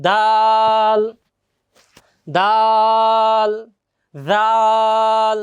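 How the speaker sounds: loud, very slow long-held letters; টেনে টেনে উচ্চারণ; drawn-out recitation. Shown as a demonstration. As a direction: chant each letter, holding it. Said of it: "একটু টেনে টেনে".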